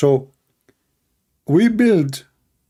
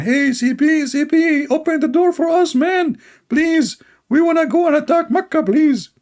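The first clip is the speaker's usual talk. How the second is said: much higher, squeaky put-on voice; high mock voice; 11 semitones higher than usual. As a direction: play the enemy in a high silly voice. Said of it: "why your voice like Lady Dawa".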